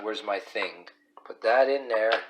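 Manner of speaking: ordinary talk, nothing marked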